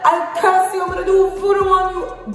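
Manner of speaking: high mock African accent, laughing through it; imitating an African man's curse, high; high mock African accent vowing voodoo; African accent threat. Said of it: "I cannot do an African accent".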